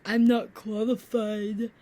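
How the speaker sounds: slow, drawn-out mock mumble; imitating a reluctant boyfriend; mopey boyfriend quote. Girlfriend mocks her boyfriend's slow reluctant excuse.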